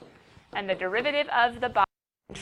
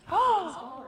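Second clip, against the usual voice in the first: mock gasp; 10 semitones higher than usual. Mock discovery of the answer.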